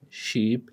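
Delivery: SHEEP with a soft sh onset; sheep, sh with no t before it; sh sound. Shown as a demonstration. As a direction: start sheep on a smooth sh. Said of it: "you must distinguish between these".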